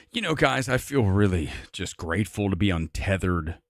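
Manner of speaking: mock-earnest, very low pensive drone; chin-stroking pretentious. Host parodying an actor's untethered-from-truth remark, low and pretentious. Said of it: "so fucking chin strokey".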